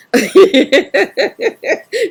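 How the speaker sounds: bright high burst of laughter; a real delighted laugh. Real, not acted. Laughing with live guests.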